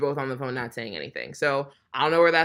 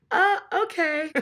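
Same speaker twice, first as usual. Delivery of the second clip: much higher, slow, drawn-out awkward reply; stunned awkward okay; 3 dB louder than usual. Retold or imitated. Nathan caught with his girlfriend, answering in an awkward high squeak.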